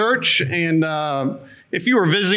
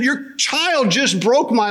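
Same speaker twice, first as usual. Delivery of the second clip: higher, slow, aggrieved complaint to the mom; overdramatic accusation; 5 semitones higher, slower than usual. Retold or imitated. Man telling a yelling mom her child broke his leg, melodramatic. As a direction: insist with wounded drama. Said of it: "the mom comes up and she starts yelling".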